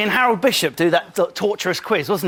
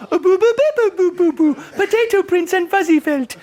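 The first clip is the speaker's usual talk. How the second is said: mocking baby voice; 9 semitones higher than usual. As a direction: squeal it like a silly toddler. Mocking a contestant's babyish chant.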